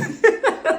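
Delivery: high-pitched burst of laughter; real laugh. Real, not acted. Host amused by an old prom photo, real laughter. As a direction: crack up right after the joke.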